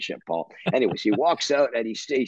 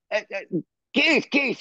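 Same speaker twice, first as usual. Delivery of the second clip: high-pitched call for attention; retold calling out; 12 semitones higher than usual. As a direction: imitate trying to catch someone walking past. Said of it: "I screamed".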